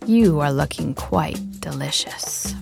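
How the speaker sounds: soft menacing whisper; hungry hyena whisper. Acted. Hyena whispering to the calf, hungry and menacing. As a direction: breathe it like a predator about to pounce.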